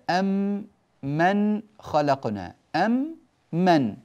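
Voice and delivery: slow, one doubled meem in أم من; merged meem. Shown as a demonstration. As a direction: press the two meems into one long m. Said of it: "فندغم وتصير".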